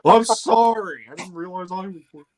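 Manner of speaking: hammed-up sarcastic sorry; mock sarcastic apology. Mock apology to bored cohost.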